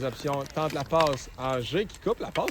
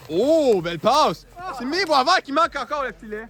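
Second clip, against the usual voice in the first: excited; 7 semitones higher, 4 dB louder than usual. Real, not acted. Exciting pass, commentator reacts.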